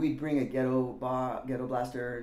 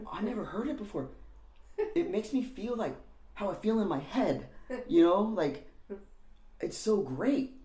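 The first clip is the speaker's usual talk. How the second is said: higher, lightly voiced starstruck teen; awed excitement; 7 semitones higher than usual. Teen telling [name] the Germs feel like his head, awed. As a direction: gush like music just changed your life.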